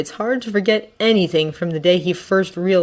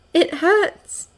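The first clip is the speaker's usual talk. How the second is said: high, hurting cry; pained; 11 semitones higher than usual. Acted. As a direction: let the pain out in a high voice. Girl in pain in the story.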